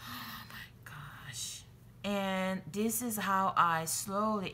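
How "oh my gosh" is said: gasp, then slow whisper; awed whisper. Real, not acted. Crafter admiring new fabric, gasping whisper. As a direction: gasp and whisper it in real delight.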